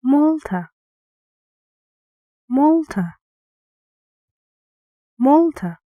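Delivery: "Malta" with "Mal" high and loud, "ta" dropping; stressed first syllable. Shown as a demonstration. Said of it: "guess which syllable is stressed".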